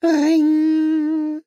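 long, high sung-out ring; phone ring. A narrator's reading. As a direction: imitate a shrill phone bell. Telephone rings in story.

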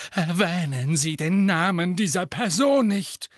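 loud and high, forbidding the name; teacher forbids a student to name her rival, loud; outraged cry. Acted. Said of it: "rief Professor Trelawney".

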